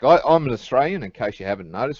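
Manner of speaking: casual Australian accent. Real, not acted. An Australian pointing out the accent to the others, Australian accent. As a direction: say it in your own broad Australian accent. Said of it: "noticed from the accent".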